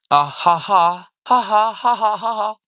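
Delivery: loud high put-on ha ha laugh; mock laugh. Real, not acted. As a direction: laugh it out in a hammed-up way. Reading laughter.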